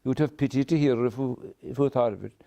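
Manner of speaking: usual speaking voice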